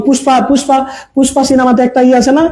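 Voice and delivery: usual speaking voice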